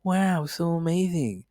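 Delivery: slow, stretched-out dreamy wow; Owen Wilson wow. Retold or imitated. Pundit continues the impression, slow and dreamy. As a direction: drag the wow out, laid back. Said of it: "I'm trying to do my Owen Wilson".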